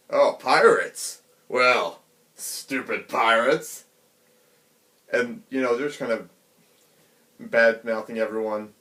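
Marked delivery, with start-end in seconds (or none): 0.1-3.8 slow, drawn-out, contemptuous thug voice; sneering bandit. Retold or imitated. A mountain bandit sneering at the pirates in the bar, slow and contemptuous. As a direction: look down on them and sneer. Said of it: "And they're like".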